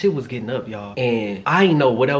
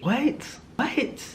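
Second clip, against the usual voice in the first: stunned whisper; 12 semitones higher, 6 dB quieter than usual. Real, not acted. Stunned aside.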